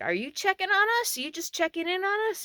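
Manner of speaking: high, loud questioning call; retold calling out. Camper questioning strangers through the screen, calling out. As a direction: pitch it up to carry outside.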